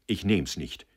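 usual speaking voice

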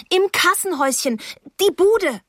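put-on agitated girl directing a helper; urgent alarm. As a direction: insist urgently in a high voice. Frantic girl points out emergency.

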